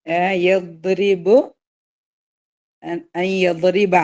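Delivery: slow tajweed chant; measured recitation; Quran recitation. Shown as a demonstration. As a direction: recite word by word, drawn out. Recites next words.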